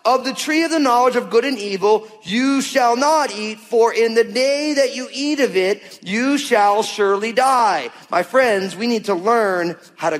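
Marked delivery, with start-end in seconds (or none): 0.0-7.9 solemn scripture reading. Acted.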